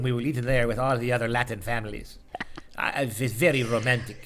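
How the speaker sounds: low, soft mock Latin lover; suave Italian romancer. Promising the co-host a romantic dinner, suave Italian romancer. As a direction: woo in a hushed, hammed-up romantic voice.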